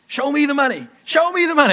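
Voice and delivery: high, loud movie-line shout; hammed-up Show me the money cry; Jerry Maguire shout. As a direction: belt it like the film's famous scene.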